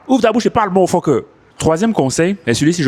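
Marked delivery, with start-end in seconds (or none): 0.1-1.2 higher, punchy exhortation; fired-up rallying cry. Real, not acted. Coach urging learners: just open your mouth and speak. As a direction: shout the motto to push them.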